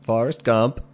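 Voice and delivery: ordinary delivery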